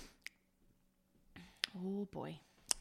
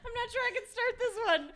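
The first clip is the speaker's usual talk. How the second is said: laughing; 13 semitones higher, faster than usual. Acted. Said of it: "in a Christopher Walken impression".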